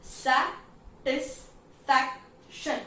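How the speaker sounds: Satisfaction slowly split as sa-tis-fac-tion; syllable by syllable. Shown as a demonstration. Teacher showing how sounds complete, syllable by syllable. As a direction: pause after each of the four beats of satisfaction. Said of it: "sound complete हो रहा है आपका".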